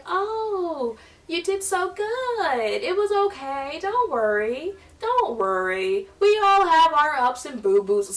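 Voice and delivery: high, sugary consoling voice, slow on so good; fake sympathy. Churchgoers consoling the embarrassed girl with sugary fake sympathy. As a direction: coo fake comfort like a churchgoer. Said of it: "They were like".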